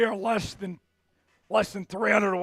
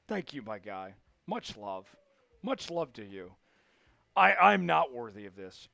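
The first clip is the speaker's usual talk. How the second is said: humble thanks; 9 semitones lower, 4 dB quieter than usual. Real, not acted.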